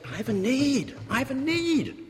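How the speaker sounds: a pleading voice rising on need; desperate plea. Retold or imitated. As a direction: cry out your need to God.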